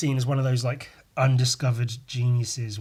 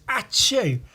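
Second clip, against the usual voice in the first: a light mock sneeze pitched higher; fake sneeze; 7 semitones higher, 3 dB louder than usual. Shown as a demonstration. Vlogger acting out a single tidy sneeze, mock sneeze. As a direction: act out one neat little sneeze. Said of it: "It's always".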